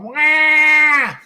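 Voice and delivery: loud high wail; kid crying wail. Retold or imitated. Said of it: "you were going".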